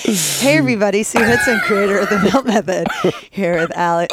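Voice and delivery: loud, bright hello to viewers; live host greeting. Real, not acted. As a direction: greet the live audience loudly. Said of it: "doing a Facebook Live".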